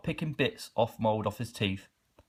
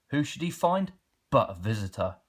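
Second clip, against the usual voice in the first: usual speaking voice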